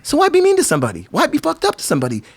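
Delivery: high, incredulous challenge; indignant questioning. Retold or imitated. Impassioned retold rebuke of mean skateboarders.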